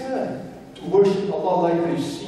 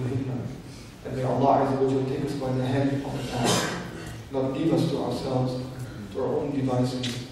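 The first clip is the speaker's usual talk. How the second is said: lower, hushed dua voice; supplication; 5 semitones lower, 5 dB quieter than usual. Acted. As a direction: pray over the congregation, gently and lower. Closing supplication for the listeners.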